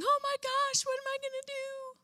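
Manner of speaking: high, squeaky mock panic; mock wailing. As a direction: ham up a panicked whiner. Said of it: "wailing and crying and going".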